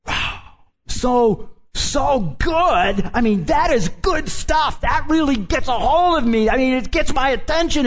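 high, loud, awestruck exclamation; amazed policemen. Retold or imitated. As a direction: gush like a stunned officer hearing something new. Policemen amazed by Jesus' teaching.

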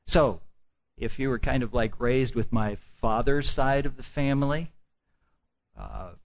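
ordinary delivery

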